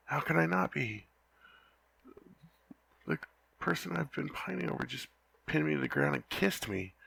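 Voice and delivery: usual speaking voice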